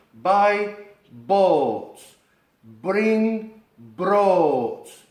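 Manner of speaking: 'buy boats, bring broads' with a long O; long English O. Shown as a demonstration. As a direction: stretch the O in 'boats' and 'broads'. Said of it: "alargar el sonido de la O".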